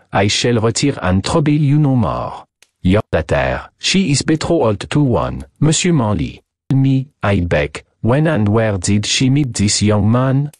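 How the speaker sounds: put-on thick French accent, courteous and pressing; French detective, eager. Detective questions madame about her daughter's fiancé, French-accented. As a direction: play a polite, excited French sleuth.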